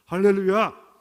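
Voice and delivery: big loud hallelujah cry; hallelujah shout. Real, not acted. Preacher rousing the congregation with a loud shout. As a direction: shout it out to the whole room.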